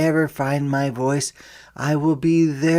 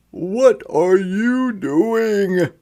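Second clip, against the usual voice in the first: slow, higher, puzzled father's question; father asking; 7 semitones higher, 4 dB louder, much slower than usual. A narrator's reading. Father puzzled at his son whispering to a jaguar, slow and higher. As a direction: draw out the question like a curious dad. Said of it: "my father asks".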